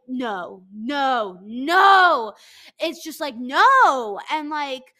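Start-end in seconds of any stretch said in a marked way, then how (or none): 0.1-2.3 no rising to a shout; building no. Real, not acted. Urging Nancy to leave Bartise, building to a loud shout. Rejecting Nancy staying with him. 3.4-4.2 loud no. Real, not acted. Emphatic rejection.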